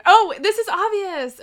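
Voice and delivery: eager outburst; excited. Real, not acted. Excited by the question.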